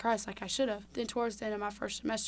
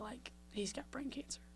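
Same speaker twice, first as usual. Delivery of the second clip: real whisper, much softer; stunned whisper; 3 semitones higher, 13 dB quieter than usual. Real, not acted. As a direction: let the realization drop into a whisper. Realizing friend's diagnosis.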